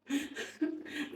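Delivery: airy, unvoiced joyful laugh; mother laughing with relief at seeing her son; breathless laugh.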